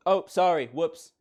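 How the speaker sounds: normal talking voice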